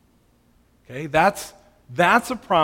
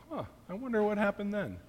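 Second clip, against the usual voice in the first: a light, higher mock-puzzled wonder; lightly voiced baffled believer; puzzled forgetful musing; 7 semitones higher, 7 dB quieter than usual. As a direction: toss it off like someone who can't recall a thing. Imitating a forgetful person in heaven.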